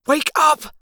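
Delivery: high, tense, restrained urging; urgent hiss. A narrator's reading. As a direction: hiss it without a full yell. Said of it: "I hissed".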